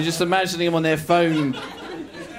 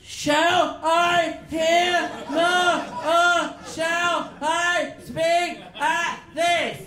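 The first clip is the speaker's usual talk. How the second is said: staccato slow reading; 13 semitones higher, much slower than usual. Acted. Struggling reader playing Romeo, slow and staccato. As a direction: read like a Dalek, one syllable at a time. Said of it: "it was very staccato".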